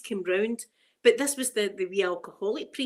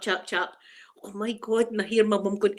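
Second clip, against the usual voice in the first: ordinary talk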